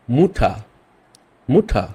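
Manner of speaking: মুঠা said 'moot-ha', U as 'oo'; German U. Shown as a demonstration. Teacher gives the German word for mother, মুঠা as 'moot-ha'. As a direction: model মুঠা with 'oo', falling at the end. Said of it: "উকে উ এর মতোই বলবেন".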